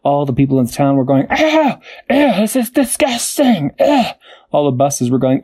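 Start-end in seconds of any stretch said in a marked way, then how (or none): 1.3-4.0 disgusted townsfolk. Acted. Townspeople disgusted by poop rain.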